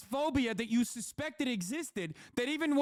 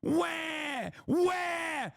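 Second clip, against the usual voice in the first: put-on high shouted baby wail; mock crying wail; 7 semitones higher than usual. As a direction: wail like a crybaby. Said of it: "That's what you sound like".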